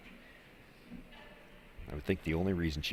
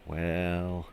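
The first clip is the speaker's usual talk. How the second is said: ordinary talk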